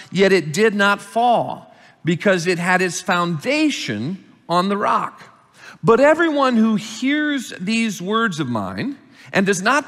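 preacher reading Jesus' words; scripture reading. Acted. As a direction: declaim the parable to the congregation. Reads parable aloud.

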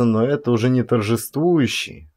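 plain reading voice

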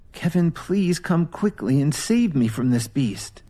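high, pleading whispered prayer; desperate prayer. Acted. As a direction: pray for rescue in despair. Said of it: "Lily prayed".

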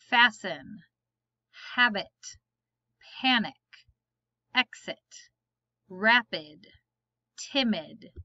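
each word said alone, clear and careful, with a pause after it; word list read aloud. Shown as a demonstration. Teacher reading spelling words to the class, slow and careful.

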